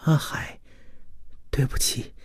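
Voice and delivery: weak, husky, pleading woman's voice; hoarse. A narrator's reading. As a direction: beg forgiveness with a spent throat. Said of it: "曹媚娘嗓音沙哑". (嗓音沙哑地哀求)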